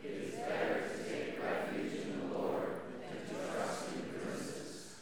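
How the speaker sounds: congregation reading together; many voices in unison. Real, not acted. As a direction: crowd reciting in unison. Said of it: "So you'll read what is in gold".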